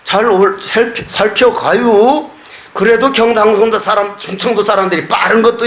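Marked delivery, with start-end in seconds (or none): 0.0-2.3 slow, drawn-out farewell; lightly mocking slow drawl of Chungcheong; slow Chungcheong speech. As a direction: stretch 살펴가요 out like a slow Chungcheong speaker. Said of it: "느려가지고".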